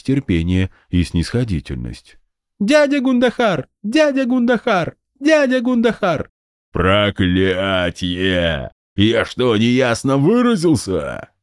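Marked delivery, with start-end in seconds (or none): 2.6-6.3 put-on high child voice calling out. A narrator's reading. 6.7-11.3 put-on a gruff, irritated old soldier; gruff general. A narrator's reading.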